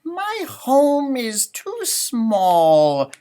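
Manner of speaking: high, slow, loud sighing rabbit voice. Acted. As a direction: stretch it out like a fed-up little rabbit. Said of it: "sighed Rabbit one day".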